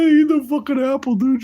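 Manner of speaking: a high cartoon voice urging the apple; squeaky mock character.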